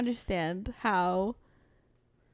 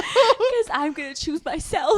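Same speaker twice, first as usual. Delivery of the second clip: high, slow laughing tease; 8 semitones higher, 6 dB louder than usual. Real, not acted. Friend jokes back about choosing herself, laughing. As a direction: chuckle it out.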